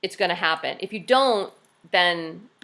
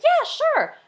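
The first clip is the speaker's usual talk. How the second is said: much higher, eager yes; demoing a cheerful, eager reply; bright eager agreement; 3 dB louder than usual. Shown as a demonstration. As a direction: chirp it back happily. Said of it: "I was like".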